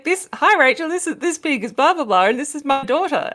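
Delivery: high-pitched mock condescension; patronizing imitation. Man introduces pig and daughter to Rachel, mock patronizing. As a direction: imitate him addressing an adult like a small child. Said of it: "addressing a fully grown woman like this".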